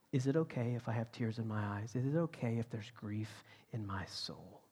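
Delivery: hushed, grieving voice of God; leaning in, confiding. Retold or imitated. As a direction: drop to a soft, intimate near-whisper, with long pauses. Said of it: "he leans in and goes".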